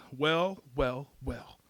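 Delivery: a touch of gloating threat; malicious relish. Acted. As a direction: savour the moment with understated malice. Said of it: "looks at me with this fresh malice".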